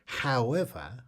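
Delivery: loud, projected fact reading; fact announcer. Real, not acted. Quiz host reading trivia to the audience, loud announcer delivery. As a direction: announce each phrase loudly, with clear pauses.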